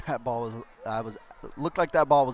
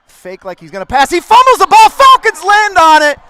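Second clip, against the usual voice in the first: excited; 20 dB louder than usual. Real, not acted. Fumble recovered, live call.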